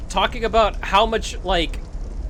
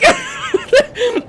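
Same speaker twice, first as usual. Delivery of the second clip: squealing, helpless laughter; high wheezing laugh; 4 dB louder than usual. Real, not acted. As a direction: lose it completely. Laughing at weird scene.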